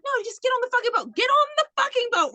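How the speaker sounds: high, fast mock urgent Jack; hammed-up order to get on the boat; imitating Jack shouting at Rose.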